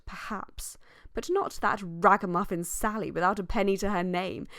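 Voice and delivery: ordinary delivery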